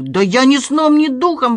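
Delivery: loud, high-pitched protest; wailed denial. A narrator's reading. Doorman denying the theft to his uncle, wailing. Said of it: "вопил Гриша".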